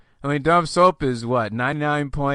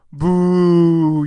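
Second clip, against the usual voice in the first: loud, long-drawn boo; mock booing; 5 semitones higher, 11 dB louder than usual. Host voicing listeners who object to the strategy, in a loud mock boo. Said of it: "you say".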